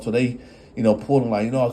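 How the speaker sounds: ordinary talk, nothing marked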